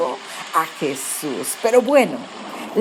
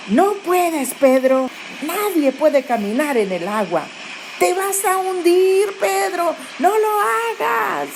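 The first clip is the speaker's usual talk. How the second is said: much higher pleading voice, slowing on the last plea; worried warning; 10 semitones higher than usual. Retold or imitated. Disciples in the boat begging Peter not to step out, high and worried. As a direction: beg him not to do it, voice climbing. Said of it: "diciéndole a Pedro algo como así".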